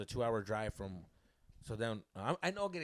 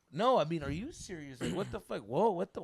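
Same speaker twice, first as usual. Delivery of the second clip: raised, indignant mock argument; exasperated yelling; 5 semitones higher, 6 dB louder than usual. Boyfriend arguing with girlfriend on phone, exasperated. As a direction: protest in disbelief. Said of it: "Why are you yelling at me".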